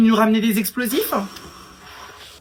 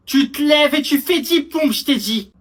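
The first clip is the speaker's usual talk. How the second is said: firm repeated order; 6 semitones higher than usual. Acted. Officer repeating the pushup order to the recruit, firmer and slower. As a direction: bark it as a real order this time.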